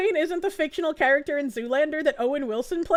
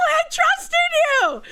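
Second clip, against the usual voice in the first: mock betrayed wail; 10 semitones higher, 4 dB louder than usual. Retelling the friend's prank as a mock betrayal. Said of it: "It's like".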